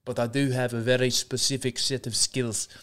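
put-on South African accent with an Irish slip; Liam Neeson. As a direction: deliver the film line in an attempted South African accent. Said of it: "Liam Neeson is still inside me".

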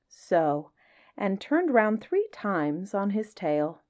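lively storybook reading with playful lilt; story narration. A narrator's reading. Reading a Kipling story to listeners, lively storybook voice. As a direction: read it like a bedtime tale to children. Reads Just So story aloud.

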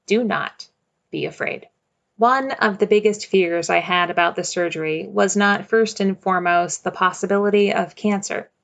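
usual speaking voice

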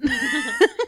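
sudden high burst of laughter. Real, not acted. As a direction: giggle high and loud. Laughs at own apology.

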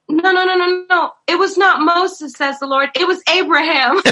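high, emphatic correction; mock self-correction. Prophetess correcting herself in front of the church, mock emphatic. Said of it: "and she was like".

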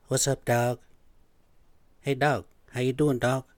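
lightly voiced South American coworker greeting; imitated South American accent. Greeting like an old coworker, imitated South American accent. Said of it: "this guy had this voice like".

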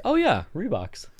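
high-pitched imagined "aha" moment; mock sudden recognition. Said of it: "And they'll think".

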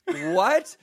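high, loud, rising disbelief; retold taken-aback yelp; mock shock. Man answering the grandma's insult, high mock shock.

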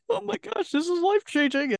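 mock gushing. Host pictures herself as a gushing, weepy client. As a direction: play a tearful client gushing over the class. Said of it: "one of those being like".

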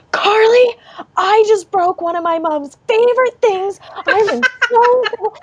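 put-on high-pitched hysterical kid confession; mock screaming. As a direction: imitate a distraught child wailing at a friend. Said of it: "She starts screaming at me".

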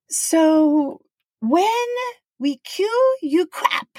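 put-on high Japanese accent giving instructions, crap for clap; Japanese-accented English. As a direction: coach the crowd in a Japanese accent. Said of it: "He's like".

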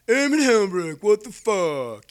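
high, loud call slowing into exasperation; imitated exasperated chief. Flight chief arriving at the hospital, imitated exasperated scolding. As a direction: chew him out by full name, drawn out.

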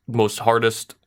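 ordinary delivery

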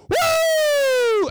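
high shrieking woo; Ric Flair woo. Retold or imitated. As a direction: let out a wild high woo. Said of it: "he just went".

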